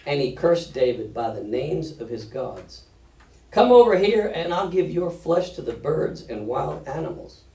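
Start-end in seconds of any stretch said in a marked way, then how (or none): 3.5-7.4 loud high yell, easing off. A narrator's reading. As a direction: open with a shouted taunt, then settle. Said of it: "Goliath yelled".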